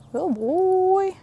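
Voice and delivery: sing-song praise. Real, not acted. Trainer praising a nervous horse, in a high sing-song.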